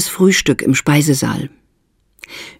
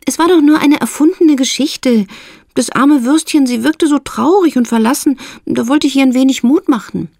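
Mildred, justifying herself; 11 semitones higher than usual. A narrator's reading.

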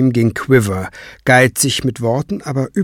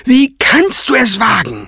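high indignant shriek; screeching; 12 semitones higher, 4 dB louder than usual. A narrator's reading. Craven outraged at Kranz's retort, screeching. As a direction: screech it as if deeply insulted. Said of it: "kreischte Craven".

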